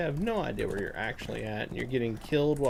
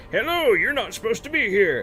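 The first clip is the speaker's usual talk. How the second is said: higher, louder mock NPC greeting; imitating game NPC; 10 semitones higher, 6 dB louder than usual. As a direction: parrot the canned NPC line. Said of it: "the guy goes".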